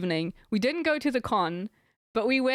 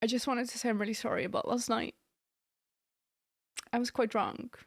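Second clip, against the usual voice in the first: quiet apology; 6 dB quieter than usual. Retold or imitated. Retold careful apology.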